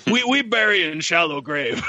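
lightly voiced simple-minded men; primitive men. Film fan mocking the men burying the box, simple-man voice. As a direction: imitate men in halting, broken speech. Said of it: "it shows the box with men".

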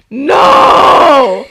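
loud outraged no; shouting no. Real, not acted. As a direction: yell it in protest. Outburst reading her notes.

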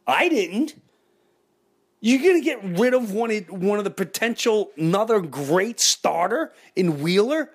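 high-pitched indignant fan rant; rant, pitch climbing with outrage; escalating comedy rant. Real, not acted. Fan rants about bad trade.

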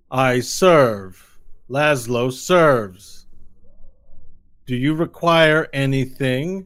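eager-to-serve minion, loud and deliberate; servile henchman. Acted. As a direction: announce your service with drawn-out words. Game master voices servant Laszlo.

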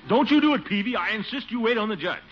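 insistent shout. Acted. Quarrel over who goes first.

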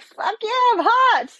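high, far-off mock bravado; mock cocky. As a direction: ham up a cocky party pose. Jokingly voicing her own photo pose.

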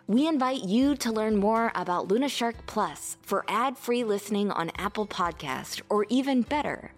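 soft, upbeat podcast ad read. Acted. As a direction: read it like a friendly sponsor spot. Sponsor ad read.

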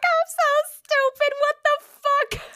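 very high, drawn-out whine; mock near-tears. Storyteller replays almost crying in the car, mock tearful whine. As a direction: stretch it into a squeaky comic wail. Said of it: "borderline almost started crying in the car".

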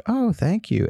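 a mock surprised thank-you, up high. Retelling the actress thanking the fan, in a mock surprised high voice. Celebrity voiced in retold meeting.

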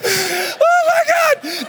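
high breathless laugh into a slow Oh my God; real laughter. Real, not acted. Speaker cracks up remembering the door, laughing hard. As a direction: crack up at the punchline.

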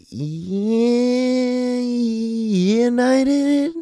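very high, drawn out falsetto Yeah, United; sing-song high mockery; mock sarcastic. Comic mocking the United Kingdom name in a very high, drawn out falsetto. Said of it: "It should be like".

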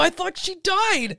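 high, slow and bewildered; a confused child, pitch way up; mock-innocent disbelief, drawn out; baffled kid.

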